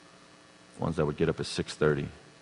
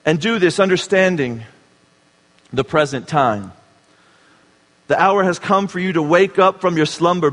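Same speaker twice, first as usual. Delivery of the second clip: higher and louder, proclaiming the verse; ringing scripture read; fervent scripture reading; 9 semitones higher, 9 dB louder than usual. Acted. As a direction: proclaim it like a wake-up call. Reads scripture with fervor.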